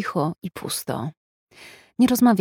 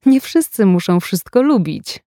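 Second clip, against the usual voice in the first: louder teasing mimicry, stress on "Nie"; przedrzeźniający ton; mocking his tone; 3 semitones higher, 6 dB louder than usual. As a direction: parrot his words back in a mocking voice. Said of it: "przedrzeźniając ton jego głosu".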